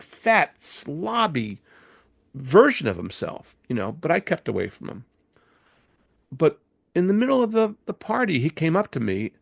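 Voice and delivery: normal talking voice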